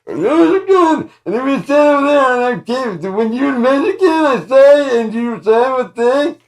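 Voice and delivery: very high, very loud theatrical voice; over-the-top stage delivery, very high and loud; stage actor projecting. Retold or imitated. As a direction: project to the back row like a ham stage actor. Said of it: "On stage, it's gonna be".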